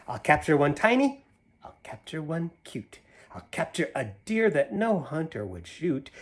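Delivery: put-on lively read-aloud to kids; animated picture-book reading; story narration. A narrator's reading. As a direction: read the rhyme with a bouncy lilt. Reads Seuss poem to children.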